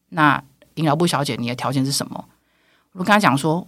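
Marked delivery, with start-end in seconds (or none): none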